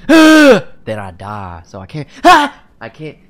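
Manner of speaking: slow, loud gasping panic; sudden loud panic. Real, not acted. Streamer panicking at the scream meter, loud gasping. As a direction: blurt it out loud, startled. Mic test triggers scream.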